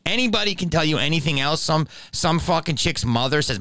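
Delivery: usual speaking voice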